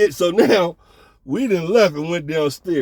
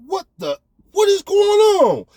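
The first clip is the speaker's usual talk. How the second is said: high startled yell, dragged out; shocked shout; 5 dB louder than usual. Retold or imitated. His shocked reaction in the room.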